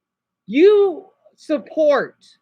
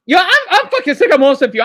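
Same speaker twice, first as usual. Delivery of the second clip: louder, fast, fed-up burst at chat; angry outburst; 4 semitones higher, 5 dB louder than usual. Real, not acted. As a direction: snap at them, higher and fast. Streamer ranting at chat.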